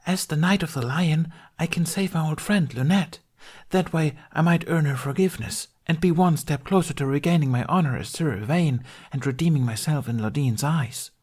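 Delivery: high, voiced stage whisper; a stagey aside to the audience, not truly whispered; theatrical aside. A narrator's reading. Knight confides in the audience, theatrical high aside. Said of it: "Tooth theatrically whispered to the audience".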